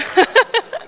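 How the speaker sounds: short high-pitched laugh. Real, not acted. Preacher amused at repeating a well-worked line, short laugh. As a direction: giggle at your own line.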